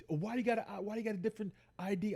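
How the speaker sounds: high, rapid questioning; cop interrogation retold. Cop questioning his ID, quick high-pitched grilling. Retelling cop's interrogation about the ID.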